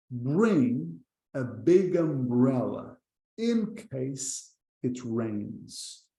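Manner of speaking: very slow, careful English sentence; slow demo reading. Shown as a demonstration. Teacher modelling slowly for learners. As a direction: draw each word out for the class. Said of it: "Segunda frase".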